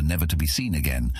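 plain reading voice

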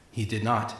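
quiet. Acted. Dejected character reply.

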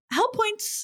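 high, teasing mock mom; lightly voiced teasing mother; mom's warning. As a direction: sing it like a knowing mom. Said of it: "she just goes".

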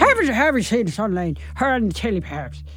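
thick, garbled mock-Irish burble; barely intelligible stage Irish; Irish accent. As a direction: burble away in a badly put-on Irish brogue. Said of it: "Shall I do an Irish accent?".

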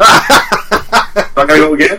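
real laughter through the words, pitch climbing; high, drawn-out, laughing complaint; laughing disbelief. Real, not acted. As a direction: protest while cracking up. Laughing over the botched take.

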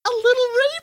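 very high, hesitant squeak; awkward high-pitched understatement; squeaky hedge. Real, not acted. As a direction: say it in a tiny high voice, gingerly. Awkward euphemism, voice jumps up.

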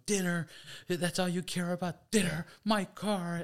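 high, loud, outraged Dinner; hammed-up teen who can't believe his mom; indignant disbelief. Teen shouting at mom about dinner, high and outraged.

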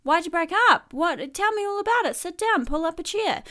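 high, eager nosy granny; prying grandma. Retold or imitated. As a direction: lean in like a grandma hungry for gossip. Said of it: "She might be like".

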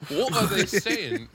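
high, drawn-out British bewilderment; hammed-up puzzled Brit; David Ditchfield. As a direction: imitate a baffled Englishman, slowly. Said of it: "David Ditchfield's like".

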